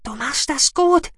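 high squeaky encouragement; whispering mouse-beaver. A narrator's reading. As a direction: cheer her on like a tiny creature. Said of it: "flüsterte der Mausbiber".

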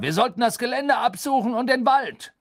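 high, loud, angry order; irate executioner urging a hunt; grousing. Acted. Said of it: "raunzte der Henker".

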